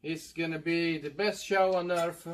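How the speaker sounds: ordinary delivery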